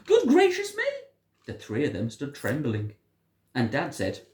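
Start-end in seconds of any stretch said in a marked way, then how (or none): 0.1-0.8 very high and loud, amazed; astonished exclamation. A narrator's reading. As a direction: gasp it out in shock. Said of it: "and exclaimed".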